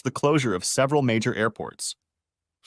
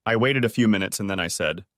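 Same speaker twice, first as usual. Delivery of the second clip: ordinary delivery, nothing marked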